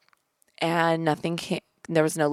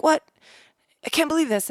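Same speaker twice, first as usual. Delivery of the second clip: pitched up high, amazed; stunned disbelief; 10 semitones higher, faster than usual. Retold or imitated. Husband watching the birth, high amazed disbelief. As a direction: replay his amazement, voice jumping up. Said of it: "he was like".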